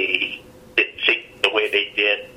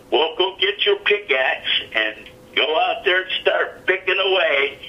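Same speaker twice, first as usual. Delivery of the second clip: higher-pitched mock jeer; imitating mocking laughter; 9 semitones higher than usual. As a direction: imitate someone making fun of an idea. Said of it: "Starts laughing. He's like".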